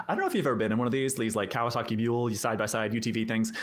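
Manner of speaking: ordinary talk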